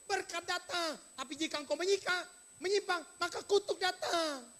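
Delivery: hectoring shout. Acted. Preacher voicing Job's friend scolding him, loud and high. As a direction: hammer the accusations home at full voice.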